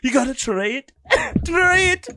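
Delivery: high, badly faked Irish accent; horrible Irish accent. Host mimicking Irish viewers urging a drink, horrible Irish accent. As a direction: do a terrible high-pitched Irish lilt. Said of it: "That's my horrible ass Irish accent".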